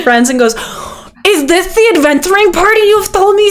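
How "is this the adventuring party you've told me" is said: high, eager character voice; enthusiastic. Acted. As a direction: ask with bubbling eagerness. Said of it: "He, like, enthusiastically shakes your hand".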